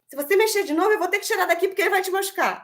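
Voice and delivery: high, fast warning; a hurried, high mock scolding; threatening parent. Shown as a demonstration. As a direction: rattle off a threat to the child. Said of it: "Eu não falei em nenhum momento".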